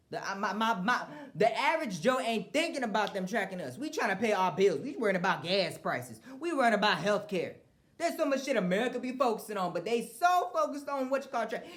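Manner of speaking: loud, high ranting at America; heated rant. Real, not acted. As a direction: rail at them, worked up.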